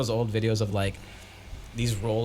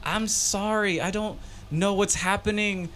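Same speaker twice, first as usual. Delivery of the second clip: high flustered apology; panicked apology; 11 semitones higher than usual. Retold or imitated. Extra apologizing to director, high and flustered. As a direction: babble sorry in confusion.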